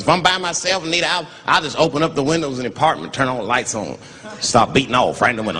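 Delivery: ordinary delivery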